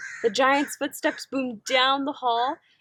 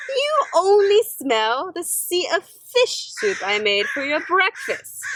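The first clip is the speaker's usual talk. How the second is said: giantess voice; 6 semitones higher, 4 dB louder than usual. Acted.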